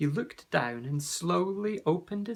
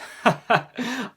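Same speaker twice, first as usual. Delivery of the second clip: hearty booming laugh; a big warm laugh thrown back at the boy; laughs out loud; 8 semitones higher, 8 dB louder than usual. A narrator's reading. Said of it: "The warrior laughed out loud".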